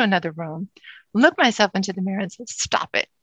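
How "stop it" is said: voiceless, under-the-breath order; hushed self-command. Acted. Client telling herself in the mirror, hushed self-command.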